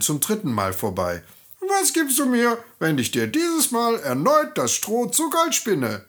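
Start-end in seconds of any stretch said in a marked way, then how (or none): 1.6-6.0 high, piping little-man voice; fluting little man. Acted. The little man greets the crying miller's daughter in a high fluting voice. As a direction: flute the greeting like a tiny sly goblin.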